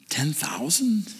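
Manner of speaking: hushed unvoiced whisper. Real, not acted. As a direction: breathe it in awe, slow. Awed aside about ten thousand.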